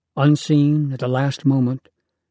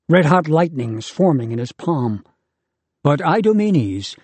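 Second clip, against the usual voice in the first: ordinary delivery, nothing marked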